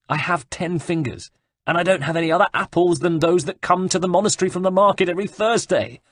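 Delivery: high, fast, exasperated monk voice; indignant monk. Acted. Monk protests to Ezio, high and fast. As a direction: blurt it out, fed up.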